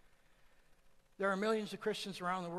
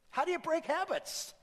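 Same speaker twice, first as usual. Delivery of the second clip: sudden high shout; 10 semitones higher, 7 dB louder than usual. Real, not acted. Preacher suddenly shouting a question at the congregation. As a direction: jump up high and loud on the question.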